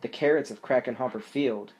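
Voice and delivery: ordinary delivery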